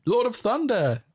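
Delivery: put-on high, loud, pompous British accent; British accent impression. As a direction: announce it in a grand British accent. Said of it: "It's quite a good impression".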